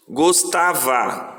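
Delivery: GOSTAVA slowly stretched as "gos-ta-va"; syllable by syllable. Shown as a demonstration. Teacher dividing gostava into pieces for pupils, slow syllables. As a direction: draw out each syllable of GOSTAVA. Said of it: "Primeiro pedaço".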